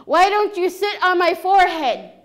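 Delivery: loud, high outburst; exasperated. Acted. Chief giving in to Frog Woman, exasperated shout. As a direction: burst out, giving up on persuading. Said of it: "he throws up his arms".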